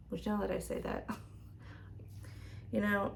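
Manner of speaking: ordinary delivery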